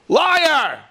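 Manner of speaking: high, loud accusing yell; furious shout. Retold or imitated. Father rips up son's money, furious shout. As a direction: spit out the accusation.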